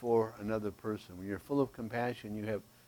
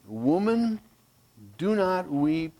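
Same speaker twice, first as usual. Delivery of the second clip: firm command; 5 semitones higher, 5 dB louder, slower than usual. Acted. Jesus's command quoted in sermon.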